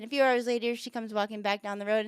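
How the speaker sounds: ordinary delivery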